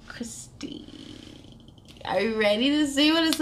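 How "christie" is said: whisper. Acted. Whispered author name.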